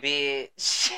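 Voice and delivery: ordinary talk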